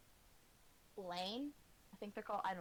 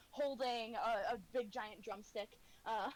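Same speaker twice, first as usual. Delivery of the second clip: ordinary talk, nothing marked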